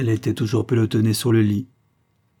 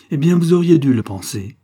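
louder, higher-pitched reproach; sharp retort; 5 semitones higher, 6 dB louder than usual. A narrator's reading. Wife blaming husband for never asking, sharp retort. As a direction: throw the blame straight back.

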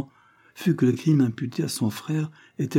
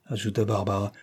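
usual speaking voice

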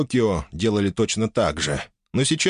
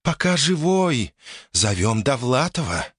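mischievous spirit; 5 semitones higher than usual. Acted.

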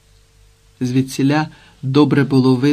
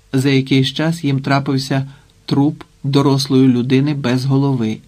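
usual speaking voice

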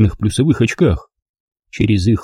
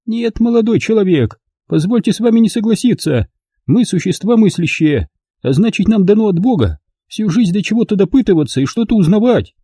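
put-on high, proud elderly noblewoman; old lady voice; 11 semitones higher than usual. A narrator's reading. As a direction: speak as a prim dignified old lady.

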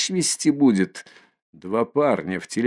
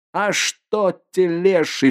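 furious outburst; 8 semitones higher, slower than usual. Acted. Mikolka at the kicking mare, a high furious outburst. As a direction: explode in rage. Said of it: "вскрикивает в ярости Миколка".

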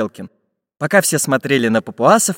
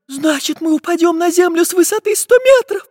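put-on very high girlish alarm; terrified little girl; 3 dB louder than usual. A narrator's reading. Girl realising the tree will fall, terrified. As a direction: squeal it out, badly scared. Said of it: "перепугалась Настенька".

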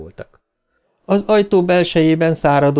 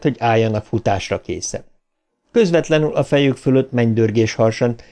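ordinary delivery, nothing marked

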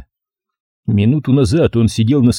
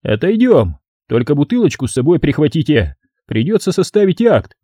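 higher-pitched official order; officious sergeant; 4 semitones higher than usual. Acted. Sergeant orders suspect along for a report, raised officious voice. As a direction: pointedly take command.

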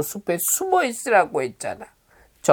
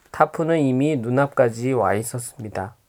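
ordinary delivery

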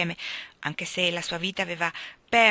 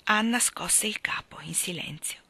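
ordinary delivery

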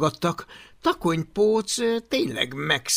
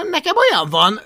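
louder, higher smug boast; grinning brag; 7 semitones higher, 9 dB louder than usual. Acted. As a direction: show off with a grin. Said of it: "vigyorgott Takonypóc". (vigyorgó dicsekvés)

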